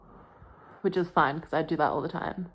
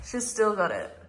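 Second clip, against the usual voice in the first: proud; 3 semitones higher, 4 dB quieter than usual. Real, not acted. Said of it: "You know what?".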